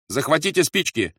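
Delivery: loud, high called command; shouted order. A narrator's reading. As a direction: call the order across the room. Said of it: "крикнул Рольф". (громкий приказ)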